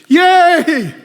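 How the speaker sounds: loud, high crowd cheer; mock cheer. The other side cheering the verdict, a loud high mock yay.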